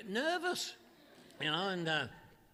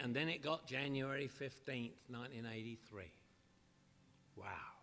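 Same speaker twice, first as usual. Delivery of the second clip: dropping lower and softer to a hush; fading to hush; 9 semitones lower, 10 dB quieter than usual. Real, not acted. Recovering alcoholic telling the meeting of his rock bottom, fading to a hush. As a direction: let it sink into near silence.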